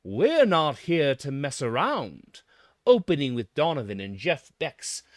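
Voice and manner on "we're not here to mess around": louder and slower, a mock-firm statement of intent; mock declaration. Announcing to the room, mock-firm and loud. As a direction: announce it to the room like a hammed-up warning. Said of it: "It announces to the room".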